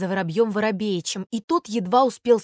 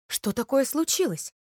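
ordinary delivery

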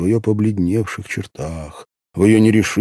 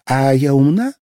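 put-on a naive girl's raised, wondering question; naive curiosity; 7 semitones higher, 5 dB louder than usual. A narrator's reading. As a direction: ask it with innocent curiosity. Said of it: "с такой наивной любознательностью".

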